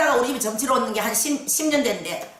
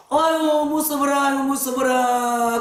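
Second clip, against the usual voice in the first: slow wailing aigo; frightened granny lament; crying grandmother. Retold or imitated. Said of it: "그러면서 막 우는 거라".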